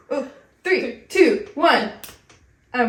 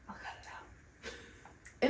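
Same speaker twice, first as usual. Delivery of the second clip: whisper; 19 dB quieter, much slower than usual. Real, not acted. Aside to herself.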